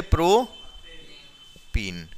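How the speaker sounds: very slow, pause between the two halves; syllable by syllable. Real, not acted. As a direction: break the name up slowly for the class. Teacher spells out the compound name.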